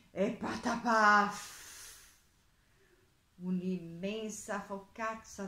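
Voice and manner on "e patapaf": slow, loud patapaf; exaggerated storybook splat; heavy splat noise. A narrator's reading. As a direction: land the last syllable with a loud thud. Narrator imitates cow pat landing.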